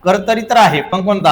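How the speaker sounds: ordinary talk, nothing marked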